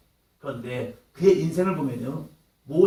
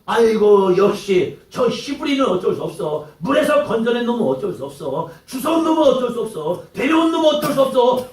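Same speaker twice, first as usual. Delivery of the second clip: contemptuous jeering building from loud to higher and louder; building jeers; 5 semitones higher, 4 dB louder than usual. Retold or imitated. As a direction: pile on the insults, rising each time. Brothers jeering at Hebrew Moses.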